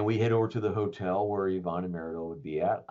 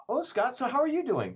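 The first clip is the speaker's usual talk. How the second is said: sweet inquiry; 14 semitones higher, 3 dB louder than usual. Retold or imitated. Yvonne asking sweetly, quoted.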